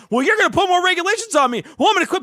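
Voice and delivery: high, fast, loud whining protest; mock indignation. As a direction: play the huffy cop threatening to walk. Imagined cops threatening to quit.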